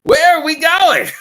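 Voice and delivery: very loud, high-pitched Aussie holler; Crocodile Hunter. Acted. Crocodile hunter greeting someone from a cruiser, exuberant Aussie shout. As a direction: shout it in an over-the-top Australian accent. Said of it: "you run into the crocodile hunger, hunter".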